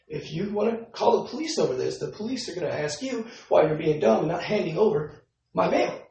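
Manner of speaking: retold anger. Tenant telling off the office man, heated and high. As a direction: tell him off as the pitch climbs. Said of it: "I'm getting upset, of course".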